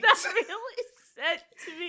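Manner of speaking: high, laughing squeal of words; real crying-with-laughter delivery; laughing to tears. Real, not acted. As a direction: squeeze the words out through helpless laughter. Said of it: "I was really crying".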